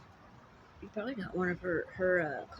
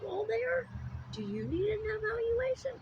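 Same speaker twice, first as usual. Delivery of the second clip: mock concern. Mocking the listener's sanity.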